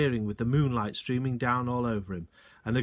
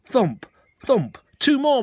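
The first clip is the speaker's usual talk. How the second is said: loud high thrilled yell; excited yell; 11 semitones higher, 10 dB louder, slower than usual. A narrator's reading. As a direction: yell it across the woods. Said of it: "my father yelled".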